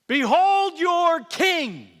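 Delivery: high, slow, booming announcement; loud proclamation. Acted. Governor presenting the prisoner to the crowd, proclaiming. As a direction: call it out to the crowd, stretching each word.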